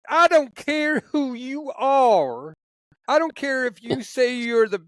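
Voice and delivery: high, drawn-out Southern trooper voice; Southern accent. Retold or imitated. Retired trooper declaring everyone believes in God, imitated Southern accent. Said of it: "do my Southern accent that he does".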